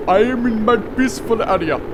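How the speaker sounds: lightly hammed-up Indian accent, high and loud; a playful Indian-accented voice for Jebediah; mock Indian accent. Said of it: "I don't know why I turned Indian".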